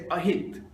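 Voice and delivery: "Ahit", the j of [name] turned into h; mispronounced name. Retold or imitated. Comic telling of cute girl's name mistake, mispronounced "Ahit". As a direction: imitate her saying "Ahit", with an h sound for the j. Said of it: "she always pronounced my name as a".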